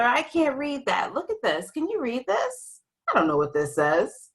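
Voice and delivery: puzzled reader. Pretend. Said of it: "all squished together".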